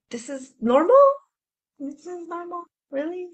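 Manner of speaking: mock incredulous high voice; incredulous body. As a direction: sound baffled that this is normal. Body mocking disbelief.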